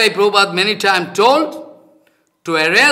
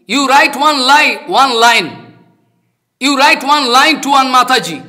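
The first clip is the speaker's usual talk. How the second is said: raised, insistent ordering voice; retold urgent command; 6 semitones higher, 5 dB louder than usual. Teacher re-enacts ringing Prabhuji with a sudden instruction, urgent command. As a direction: give the order high and loud, insisting.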